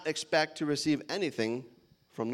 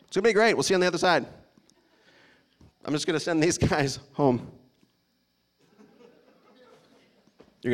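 lightly voiced, teasing Jesus sending them off; a hint of a knowing smirk; 3 dB louder, faster than usual. Pastor imagines Jesus waving the disciples off, teasing. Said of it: "Jesus had a smirk on his face".

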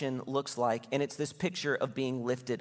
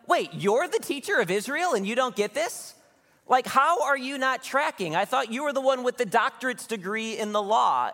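higher, lightly mocking teacher jab; mocking teasing; 7 semitones higher, 4 dB louder than usual. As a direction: tease him like a puzzled friend. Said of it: "He just starts making fun of Nicodemus".

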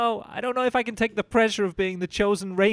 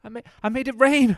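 excited; 7 semitones higher, 8 dB louder than usual. Acted. Kid triumphant.

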